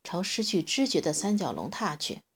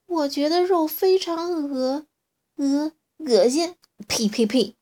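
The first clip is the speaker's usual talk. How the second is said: disgusted; 9 semitones higher, 8 dB louder, slower than usual. A narrator's reading. Disgusted at eating meat.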